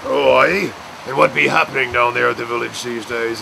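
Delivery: lightly voiced rough village barman; a hint of a barman you don't mess with; gruff barman voice. Pete auditioning as the village barman, gruff. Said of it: "he put on this barman voice".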